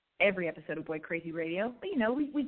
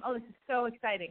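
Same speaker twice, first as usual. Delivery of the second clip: ordinary delivery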